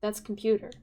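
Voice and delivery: usual speaking voice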